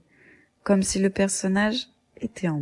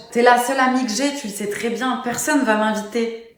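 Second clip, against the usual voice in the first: normal talking voice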